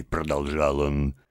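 ordinary delivery

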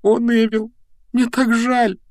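put-on high, plaintive girl's lament; high-pitched, pitying regret; sorrowful apology. A narrator's reading. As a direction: wail a gentle apology. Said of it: "грустно ответила Гермиона".

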